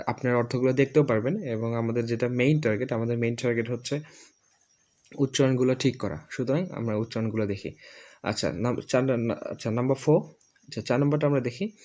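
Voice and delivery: ordinary talk, nothing marked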